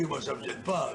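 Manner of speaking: ordinary talk, nothing marked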